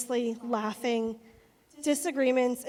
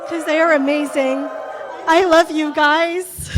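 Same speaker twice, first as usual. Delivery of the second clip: joyful toast; 3 semitones higher, 14 dB louder than usual. Real, not acted. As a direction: call it out to the whole room with joy. Closing toast, calling out to couple.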